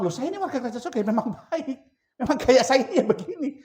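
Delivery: high, loud laughing boast; proud exclaim. Acted. Preacher playing a proud citizen of heaven, high and laughing.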